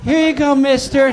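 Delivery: put-on high little kid voice. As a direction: hand it up like a small child. Kids handing over cups.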